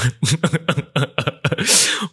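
bursting into a high laugh; high amused laugh. Real, not acted. Joking about near death.

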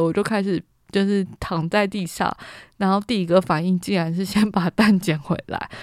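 ordinary talk, nothing marked

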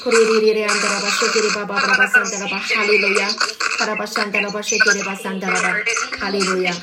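speaking in tongues. Real, not acted. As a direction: pour out tongues fervently, in a steady chant. Praying in tongues aloud.

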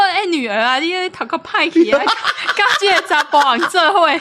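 妈妈半笑着骂女儿; mock scolding mother. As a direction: ham up a stern mother in Taiwanese. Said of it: "太后娘娘生气了".

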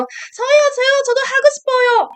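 high, fast, excited begging in Korean; eager pleading call. Retold or imitated. A fan at a fanmeet begging the idol to let her play, high fast pleading. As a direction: call out to be picked, rapid-fire.

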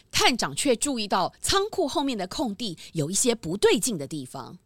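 ordinary talk

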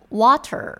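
"water" with a rolled American R at the end; American "water" with the R sounded; American pronunciation. Shown as a demonstration. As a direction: say "water" the American way, curling the tongue on the final R. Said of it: "我们美式就会念".

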